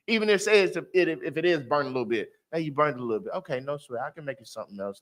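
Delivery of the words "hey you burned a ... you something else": gentle wife voice. Retold or imitated.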